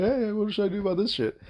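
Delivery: high-pitched clueless advice seeker; mocking imitation. Mimicking a sister asking a nurse for advice, mocking.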